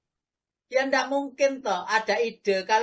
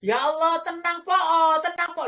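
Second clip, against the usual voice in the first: loud exasperated cry of be patient; impatient sabar; 7 semitones higher, 5 dB louder than usual. Retold or imitated. Preacher mocks an impatient man loudly urging patience. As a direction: bark patience while losing it yourself. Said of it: "sing ngomong tenang itu loh, gak tenang".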